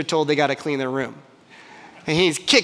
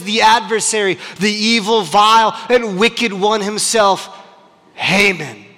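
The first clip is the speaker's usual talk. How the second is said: ordinary talk, nothing marked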